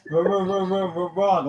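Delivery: laughing through a mock Fonz wrong. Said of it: "like the Fonz".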